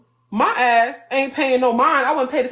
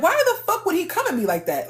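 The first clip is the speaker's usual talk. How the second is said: retold outrage; 6 semitones higher, faster than usual. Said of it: "Because I said".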